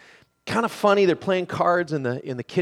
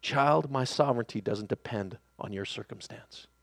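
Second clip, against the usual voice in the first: low, soft voice of God; hushed, tender correction to a child; gentle whisper; 5 semitones lower, 7 dB quieter than usual. Acted. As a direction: speak it quietly and low, calmly sovereign. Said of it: "whispers this".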